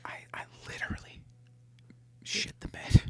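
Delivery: hushed confession; whispering. Pretend. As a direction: whisper it, mortified.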